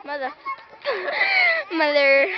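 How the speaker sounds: cackling shriek, then yelled word; laughing shout. Real, not acted. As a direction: lose it laughing and yell.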